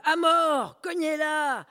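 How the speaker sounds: very high, loud cries; mob yelling. Acted. Crowd screaming to beat the woman, loud. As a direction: scream for blood like a lynch mob. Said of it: "La foule".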